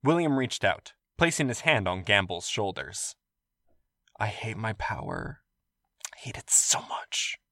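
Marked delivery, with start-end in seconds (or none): 4.2-7.4 soft, unvoiced whisper. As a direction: breathe it out in bitter whisper. William's private lament.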